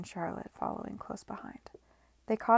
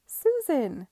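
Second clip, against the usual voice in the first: much higher and louder cry of recognition; surprised exclamation; 7 semitones higher, 10 dB louder than usual. A narrator's reading. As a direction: blurt the name before you can stop. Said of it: "she exclaimed".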